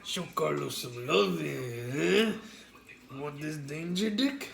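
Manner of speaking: slow, drawn-out mock Spanish accent; put-on accent. Teasing co-host by voicing Carlos Danger, mock Spanish accent. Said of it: "How's my accent?".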